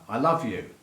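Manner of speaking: normal talking voice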